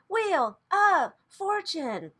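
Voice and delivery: slow, high, drawn-out announcer call; game show announcer. Pretend. Mocking the card as a game show announcer. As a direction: sing it out like a game show host.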